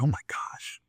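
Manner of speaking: very soft, voiceless awe; stunned whisper. Acted. Man hangs up after landing the meeting, stunned whisper. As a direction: whisper it to yourself, amazed.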